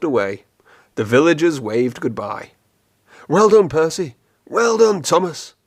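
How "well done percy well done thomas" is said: louder and higher cheering crowd; cried out. A narrator's reading. Villagers waving goodbye to the engines, loud high cheer. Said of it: "they cried".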